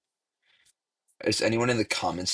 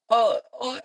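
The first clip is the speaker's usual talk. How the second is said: mock dramatic oh; 13 semitones higher, 4 dB louder than usual. Dramatic edit sound.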